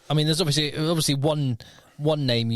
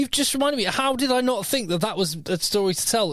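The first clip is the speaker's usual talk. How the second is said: excited; 9 semitones higher than usual. Real, not acted. Suddenly remembers a story.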